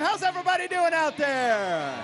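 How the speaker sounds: loud hype host at a racetrack; announcer shout. Real, not acted. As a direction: call out to the crowd. Host opening live show crowd.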